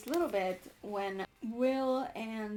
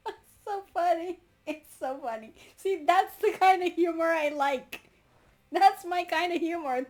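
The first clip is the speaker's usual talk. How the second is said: delighted; 7 semitones higher, 10 dB louder than usual. Real, not acted. Delighted by the joke.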